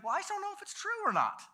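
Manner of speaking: put-on high, quick child voice; high-pitched, defensive little boy; doubtful kid. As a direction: imitate a kid unsure what to believe. Doubtful child answers zealous friend.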